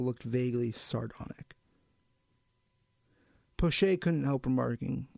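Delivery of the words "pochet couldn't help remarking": plain reading voice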